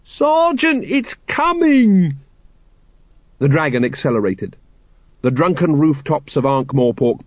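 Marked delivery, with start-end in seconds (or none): 0.0-2.1 very high stretched-out cry; shouting. A narrator's reading. Carrot warning that the dragon is coming, shouting. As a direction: shout the alarm, drawing out the end. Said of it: "when you keep shouting".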